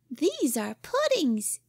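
put-on slow, high delighted girl's cry; proud of her cooking; gleeful yell. Cora announcing puddings to Jimmy: gleeful high girl voice. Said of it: "yelled the angel child gleefully".

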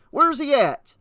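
agitated, high-pitched demand; shout. A narrator's reading. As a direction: shout for an answer in a hurry. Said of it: "Homer shouted".

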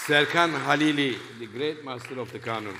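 loud, fast announcer call of the name; stage introduction. Real, not acted. Host presenting the Kanun master to the audience, loud announcer call. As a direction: call out the musician's name to the hall.

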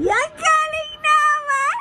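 high, slow, delighted praise; excited praise. Real, not acted. Praising toddler.

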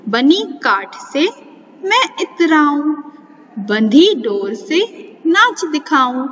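put-on sing-song riddle recitation for children; reciting a riddle. Shown as a demonstration. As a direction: lilt the rhyme like a playful puzzle. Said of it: "अब हम कुछ पहेलियाँ पढ़ेंगे". (बच्चों को पहेली लय में सुनाना)

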